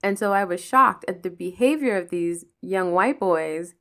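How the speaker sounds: usual speaking voice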